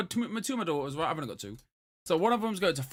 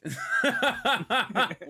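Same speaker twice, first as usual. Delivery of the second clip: higher, rolling laugh in bursts; 6 semitones higher than usual. Real, not acted. Comic laughing at the co-host's remark, a rolling laugh.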